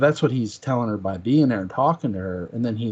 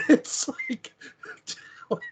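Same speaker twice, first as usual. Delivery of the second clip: high laughing "It's like" into a breathy "what?"; laughing disbelief; 5 dB quieter, slower than usual. Real, not acted. Laughing at the quote.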